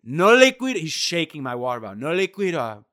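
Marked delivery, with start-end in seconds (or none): 0.0-0.8 high, loud mock yell in Spanish; imitated yelling. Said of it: "He's yelling at me about the water". 2.0-2.8 mild mock yell in Spanish; imitated yelling. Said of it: "He's yelling at me about the water".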